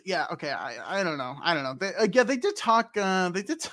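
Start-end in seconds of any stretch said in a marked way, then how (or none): none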